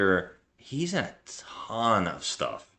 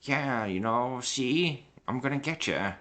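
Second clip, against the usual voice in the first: lightly put-on mobster, pitched higher; 5 semitones higher than usual. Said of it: "doing that stereotypical mobster voice where he's like".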